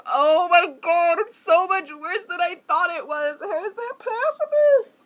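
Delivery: very loud, high dramatic wail; hammed-up anguished outburst; wailing mock horror. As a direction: build to a high, tearful cry of disbelief. Acts horror at recorded voice.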